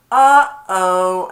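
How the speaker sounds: loud high squeaky uh-oh; worried uh-oh. Retold or imitated. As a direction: realise you are in big trouble. Abu realises the danger.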